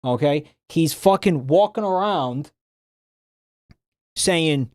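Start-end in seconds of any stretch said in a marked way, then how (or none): none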